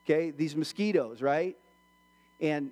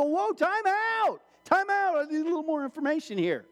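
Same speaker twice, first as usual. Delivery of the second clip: high-pitched mock panic, first words stretched; acted freak-out; 9 semitones higher than usual. Patient about to be jabbed with a needle, freaking out. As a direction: halt them in exaggerated alarm. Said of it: "you're freaking out".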